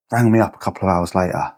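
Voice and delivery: usual speaking voice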